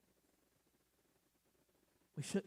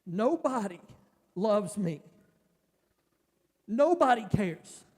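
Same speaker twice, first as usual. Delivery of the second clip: loud, much higher, slow anguished outcry; anguished cry; 10 semitones higher, 6 dB louder than usual. Retold or imitated. A broken man crying out to God in bed, anguished and loud. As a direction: pour out the hurt of being abandoned.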